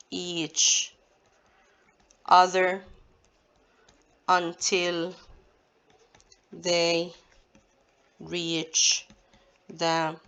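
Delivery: slow word-by-word dictation with long pauses; measured, level delivery, each word set apart; dictating. Shown as a demonstration. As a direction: dictate for note-takers, one word at a time. Dictates answer while writing it.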